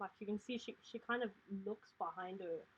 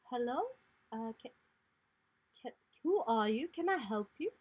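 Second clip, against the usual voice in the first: put-on raised, hesitant child voice; a timid little girl calling through the door; small girl stammering at the door; 4 semitones higher, 6 dB louder than usual. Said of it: "she looks up at you and she says".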